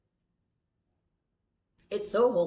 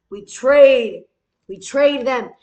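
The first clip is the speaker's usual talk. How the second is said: mock whiny insistence; insistent loud demand; 3 semitones higher, 11 dB louder, slower than usual. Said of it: "And I was like".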